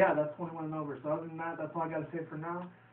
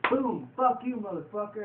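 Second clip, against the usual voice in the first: loud, higher-pitched punch-and-curse imitation; mock angry outburst; 6 semitones higher, 5 dB louder than usual. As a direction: act out landing a punch. Acting out a punch and insult.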